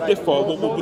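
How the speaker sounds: ordinary talk, nothing marked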